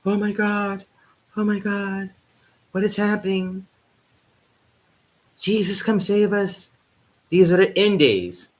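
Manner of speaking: high, slowing mock doomsday wail; hammed-up churchgoing grandma panic; mock panicked elders. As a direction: plead to Jesus like a horrified old relative. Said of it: "the people in my family will be going".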